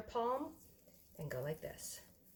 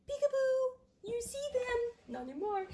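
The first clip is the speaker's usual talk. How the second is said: much higher playful peekaboo voice; peekaboo baby talk; 3 dB louder than usual. Pretend. Playing peekaboo with dough.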